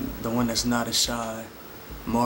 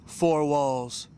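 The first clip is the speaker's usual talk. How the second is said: higher-pitched, rhythmic poem recital; measured opening building into flowing, alliterative verse; spoken word poetry; 4 semitones higher than usual. Acted. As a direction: perform it like a slam poet.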